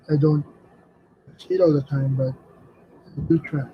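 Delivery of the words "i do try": ordinary talk, nothing marked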